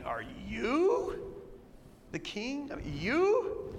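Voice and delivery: doubtfully eye the beaten man; incredulous Pilate. Retold or imitated. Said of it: "Pilate is literally saying".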